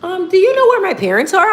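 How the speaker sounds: put-on high, pleading kid voice asking a stranger; boy in a store asks where his parents are, high and whiny; lost little kid. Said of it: "he was like".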